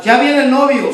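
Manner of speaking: loud, high announcing call; the bridegroom is coming, shouted to the sleeping women; retold shout. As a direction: cry it out at midnight. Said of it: "se oyó gritar".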